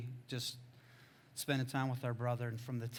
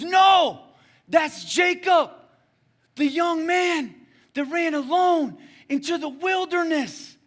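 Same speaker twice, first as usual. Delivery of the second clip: high, slow, insistent yell; shouted revelation; 15 dB louder, slower than usual. Retold or imitated. Friend insisting to the preacher on who Jacob is, shouting. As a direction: hammer it home at full volume. Friend's realisation, retold at full volume.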